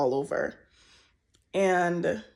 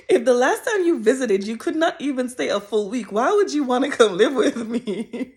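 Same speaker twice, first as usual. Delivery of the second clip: raised and louder, half laughing in disbelief; incredulous question to mom; 4 semitones higher, 6 dB louder than usual. Retold or imitated. Retelling her doubts about her mom moving in, incredulous half-laugh. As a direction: ask it like the idea is absurd. Because I'm like.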